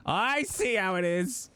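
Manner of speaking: drawn-out pretend indignation; mock offended. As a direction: play wounded pride for laughs.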